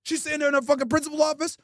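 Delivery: normal talking voice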